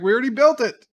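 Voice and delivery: high, loud blurted protest; retold exclamation. Engineer answers why help is needed, exclaiming. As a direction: blurt it out, pitch jumping up. Retold argument over the ship.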